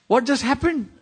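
high-pitched, stunned comic question; hammed-up baffled cry; mock bewilderment. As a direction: ask it as if the world just flipped. Continues the mock shock.

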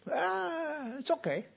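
high, soft whiny dog voice; mock contented dog. Preacher voices the dog accepting a book: high soft whine. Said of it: "And the dog was".

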